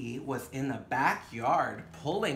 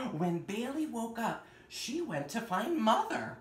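story reading; 8 semitones higher than usual. Acted. Reads picture book aloud.

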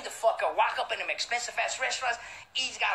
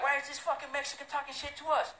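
imitating the rivals; 6 semitones higher than usual. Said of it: "They were looking at me like".

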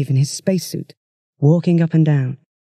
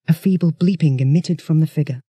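plain reading voice

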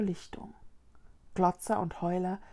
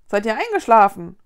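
loud puzzled call; 11 dB louder than usual. Acted. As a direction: call across to the ghosts. Vicky calling to silent ghosts.

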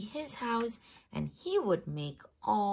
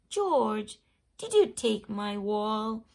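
louder accusing question; 2 semitones higher, 9 dB louder than usual. Acted. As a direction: call him out. Neighbor confronts George.

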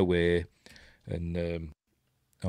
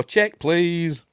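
higher deadpan quip; lightly voiced crew asking to leave; mock crew line; 9 semitones higher, 6 dB louder than usual. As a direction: ask for the bill in a hurry. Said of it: "they all go".

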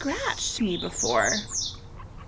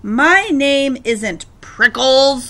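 slow, louder, mildly indignant retort; yelling back; 2 semitones higher, 8 dB louder, much slower than usual. A narrator's reading. Hank answering the jackrabbit's nickname, yelling back. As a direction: yell back a slow correction. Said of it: "yelled back".

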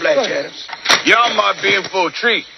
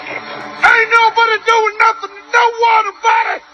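drill sergeant shout; 14 semitones higher than usual. Acted. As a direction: bark the orders across the squad. Sergeant barking orders at troops.

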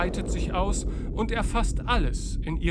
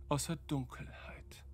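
whisper; 11 dB quieter than usual. A narrator's reading. Narrator whispers darkness.

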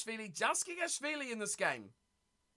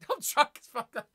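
laughing; 4 dB louder than usual. Real, not acted. Reads chat message laughing.

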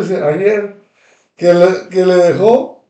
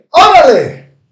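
loud high challenge; sharp shouted come-on; shouted dare; 6 semitones higher, 6 dB louder, slower than usual. Real, not acted. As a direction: fling the dare out hard. Daring the cousin to respond.

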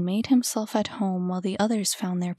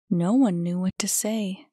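plain reading voice

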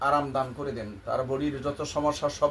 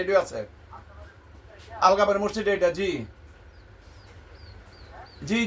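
raised voice, calling down a phone line; loud phone voice; 6 semitones higher, 4 dB louder than usual. Real, not acted. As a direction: speak up, giving directions by phone. Giving directions over the phone.

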